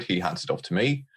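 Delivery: ordinary delivery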